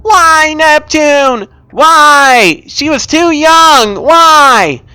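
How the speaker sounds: high, loud wail to the sky, slowing on the middle line; anguished sobbing cry. Acted. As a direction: cry out to the heavens over her body. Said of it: "began to sob humanly".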